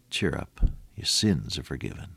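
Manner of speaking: low, soft and almost whispered; tender hush. Acted. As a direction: breathe it like a comfort. Jesus' words, hushed tender.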